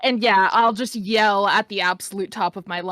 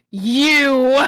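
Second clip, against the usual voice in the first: loud, higher, drawn-out accusing "you"; in-character callout; 6 semitones higher, 9 dB louder than usual. Acted. Player's character turns on someone, loud callout. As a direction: call it out loud and high, then break into laughter. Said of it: "turn and be like".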